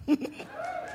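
high burst of laughter; laughing. Real, not acted. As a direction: laugh at the joke. Laugh after joke.

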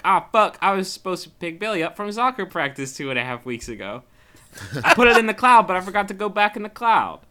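sudden panicked yell; panicked realization. Retold or imitated. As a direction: blurt it out in shock.

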